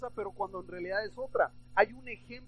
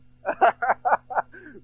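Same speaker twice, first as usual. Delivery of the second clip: loud burst of laughter; real laughter; 3 semitones higher, 12 dB louder than usual. Real, not acted. Preacher caught using a listener's name, loud real laugh. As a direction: laugh out loud at your own slip.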